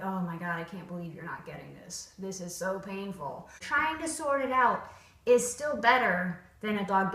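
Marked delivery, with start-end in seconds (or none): 0.0-3.5 lower, softer, exasperated mutter; quietly fed up trainer. Shown as a demonstration. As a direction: groan at the dog's failure. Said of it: "that face of like".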